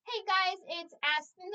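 ordinary delivery

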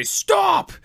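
high, loud sudden yell to halt; barked stop. Retold or imitated. Sergeant major halting a soldier in the barracks, sharp shouted command. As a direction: stop him dead in his tracks. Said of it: "I hear this familiar voice say".

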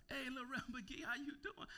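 put-on high, cheery greeting of an old friend; friend's greeting. Friend greets him again.

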